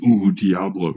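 a loud, drawn-out ooh before Diablo; spooky Diablo. Shown as a demonstration. Streamer hamming up the Diablo name for chat, mock-spooky. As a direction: savor the name with a theatrical ooh. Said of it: "Do you know what it means?".

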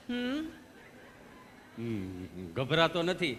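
low, slow, hesitant humming; stammering patient. Retold or imitated. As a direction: drag it out, stuck on each word. Gabho stammering reply.

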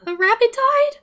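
very high, loud disbelief; shocked outcry. Real, not acted. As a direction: shriek in stunned dismay. Shock at rabbit's death.

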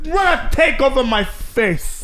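mock Russian accent. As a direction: cry it out slowly as a hammed-up Russian. Playing the Russian woman.

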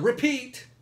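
loud, high interrupting yell; full-voiced high holler over the speaker; heckler's shout. A narrator's reading. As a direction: cut in with a shouted demand. Crowd cuts in on the boy.

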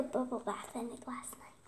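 soft secret whisper; hushed little confession; whispered aside. Real, not acted. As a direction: lean in and whisper it.

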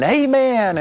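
a high, fervent mock "Amen"; mock audience amen. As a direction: echo a worked-up congregant's "Amen". Audience responding to TV preacher.

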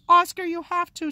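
high, loud urgent call; girl in the water shouting up to the boy on the plank. Acted. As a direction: yell up at him to make him jump. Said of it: "she shouted".

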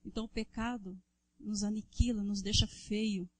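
loud, driving sermon to the congregation; fervent preaching. Acted. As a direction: preach it hard, calling out to the brothers. Preaching at full force. (pregação inflamada e forte)

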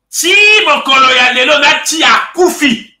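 loud, high shouted line; quoted shout. Retold or imitated. Commentator voicing a figure's loud Lingala outburst. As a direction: belt it out as the quoted man.

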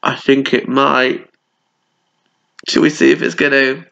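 plain reading voice

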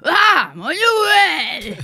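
loud, high football fan yell; grito de hincha. Retold or imitated. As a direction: bellow the club chant like Kiko out drinking. (grito fuerte de hincha borracho de Newell's)

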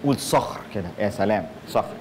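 low, soft relish; hushed, low enjoyment of the word; savouring the word. Shown as a demonstration. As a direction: linger softly over صخر as if teaching Arabic. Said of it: "تستمتع بالكلمة".

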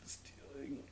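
very soft, whispered aside. Real, not acted. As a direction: whisper the word under your breath. Repeats word under breath.

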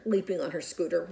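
ordinary delivery

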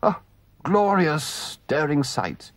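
rapturous, slowed, lightly voiced toad; dreamy murmur. Toad gazing after the car, entranced. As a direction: sigh it out in a daze. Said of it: "murmured Toad".

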